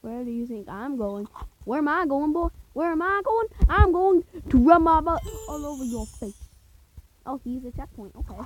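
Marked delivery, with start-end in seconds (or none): 1.6-6.4 higher, louder teasing threat; mock taunt. Gamer taunting the game character, higher teasing threat. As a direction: taunt the character like a bratty kid.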